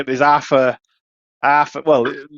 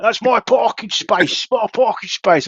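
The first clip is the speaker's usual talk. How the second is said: high, slow mock Londoner; London accent; 10 semitones higher, slower than usual. Bloke claims his parking space, London accent. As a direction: stake a claim in put-on London. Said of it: "and he's like".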